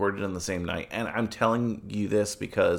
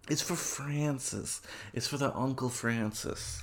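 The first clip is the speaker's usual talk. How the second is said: very soft, mock-solemn appeal; hushed plea; 3 semitones higher, 10 dB quieter than usual. Host begs listeners for reviews on Uncle Francis's behalf, hushed plea. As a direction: drop to a hush, slowing down.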